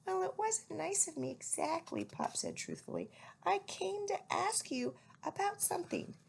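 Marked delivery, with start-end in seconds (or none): 0.1-2.0 owlet voice. A narrator's reading. Plop voiced in story. 3.4-6.2 owlet voice. A narrator's reading. Plop voiced in story.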